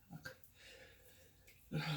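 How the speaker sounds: muttered swear under breath; whispered curse. Real, not acted. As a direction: hiss it to yourself. Smears product, curses under breath.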